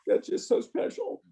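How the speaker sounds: choked up, holding back tears; getting emotional. Real, not acted. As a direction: voice breaking with emotion, rising high. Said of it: "I don't know why I'm getting emotional".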